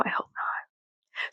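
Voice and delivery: hushed, breathy aside; whisper. Real, not acted. Whispering an aside to viewers so nobody hears. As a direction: whisper it like a secret. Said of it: "in case this person is watching".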